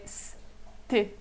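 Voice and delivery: st said dry and unvoiced. Shown as a demonstration. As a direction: clip st short and dry. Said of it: "aqui é seco".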